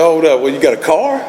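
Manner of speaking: spring the next demand with amusement; amused God. Retold or imitated. God bargaining with the alcoholic, lightly amused. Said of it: "God started laughing".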